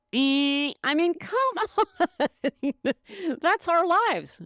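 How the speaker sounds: high, loud laughing protest; laughing disbelief. Real, not acted. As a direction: laugh it out, can't believe it. Laughs at absurd question.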